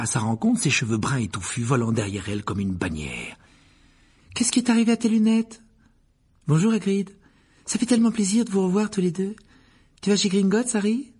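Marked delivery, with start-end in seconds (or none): 4.3-11.1 put-on much higher, lively girl's voice; eager girl, pleased. Hermione greets Harry and Hagrid, eager and pleased. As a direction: greet both friends eagerly.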